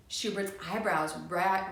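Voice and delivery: ordinary delivery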